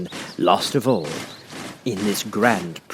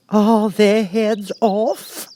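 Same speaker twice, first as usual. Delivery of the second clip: high, slow, lofty Queen voice; imperious Queen; 13 semitones higher, 5 dB louder, much slower than usual. A narrator's reading. The Queen questioning Alice, lofty and slow. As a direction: look down on the child from the throne.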